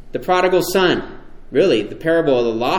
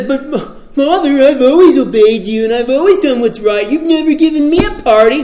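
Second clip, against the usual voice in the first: high, loud whiny complaint to his father; self-righteous son; 9 semitones higher, 4 dB louder than usual. Acted. Older son complaining to his father, high whiny grievance. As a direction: sulk it out like the jealous older brother. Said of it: "The self-righteous son says".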